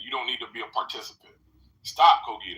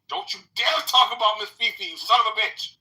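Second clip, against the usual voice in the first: angry; 8 semitones higher, 7 dB louder than usual. Real, not acted. Defending Miss Fifi, insulting Cogito.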